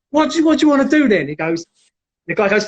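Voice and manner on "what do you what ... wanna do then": higher, quick retort; raised-pitch, hurried comeback; challenged retort. Retold or imitated. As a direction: answer a dare, imitating the rival. Challenged man answers in retold standoff.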